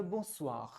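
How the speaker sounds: bonsoir said the French way, bõsoir with a nasal on; French pronunciation. Shown as a demonstration. Teacher modelling French for students. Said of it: "तो हम कहेंगे French के अंदर".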